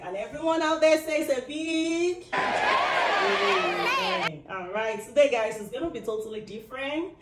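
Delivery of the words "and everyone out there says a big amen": high, loud and slow, laughing; excited. Real, not acted. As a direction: draw it out with a laugh. Calls for big amen.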